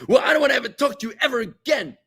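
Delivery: high, loud huffy outburst; imitated shout. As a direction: storm off like a sulking debater. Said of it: "they shout at you".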